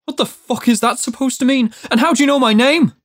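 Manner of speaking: high, agitated, quickening questions; angry, alarmed demand. A narrator's reading. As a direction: fire questions in rising agitation. Judd demands what it means.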